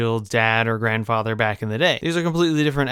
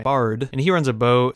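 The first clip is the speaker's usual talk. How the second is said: ordinary talk, nothing marked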